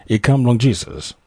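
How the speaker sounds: usual speaking voice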